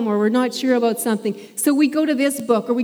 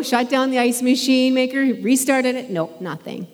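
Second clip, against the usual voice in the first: usual speaking voice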